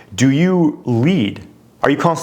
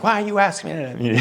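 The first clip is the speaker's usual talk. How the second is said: higher, whining mock complaint; nagging whine; 6 semitones higher than usual. Husband imitating his wife being rude, mock whining. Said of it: "kinda nagging, whining".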